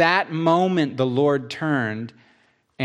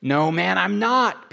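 retort; 5 semitones higher, slower than usual. Acted.

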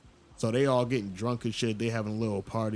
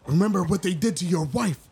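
high, loud mocking taunt; hammed-up villain taunt; 9 semitones higher, 3 dB louder than usual. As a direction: jeer at him, building to a yell. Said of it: "he was like".

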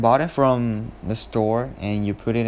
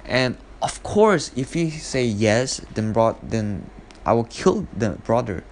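ordinary talk, nothing marked

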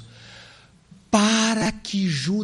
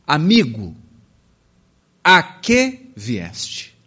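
solemn tender; 3 semitones lower, 5 dB louder, much slower than usual. Acted. Jesus greeting Judas.

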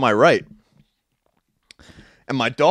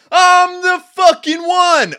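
full-throated, drawn-out high scream; 8 dB louder, much slower than usual. Retold or imitated. Bodybuilder on his balcony every morning, screaming. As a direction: scream it long and high like a battle cry. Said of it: "throw his hands in the air and scream".

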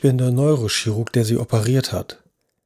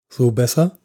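a touch louder and clearer. A narrator's reading. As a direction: lift the voice a little, speak more clearly. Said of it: "Die Stimme war nun viel lauter und deutlicher". (die Stimme etwas lauter und deutlicher)

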